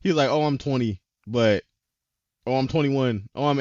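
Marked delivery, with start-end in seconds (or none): none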